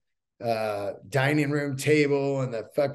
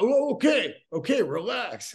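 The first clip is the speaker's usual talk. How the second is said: backing-down thief; 8 semitones higher than usual. Retold or imitated. Thief backs down.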